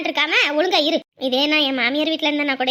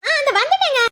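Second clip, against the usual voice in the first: high-pitched harried answer; calling back; 5 semitones higher, 3 dB louder than usual. Acted. Wife answering her husband's summons, calling back. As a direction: call out that you are on the way.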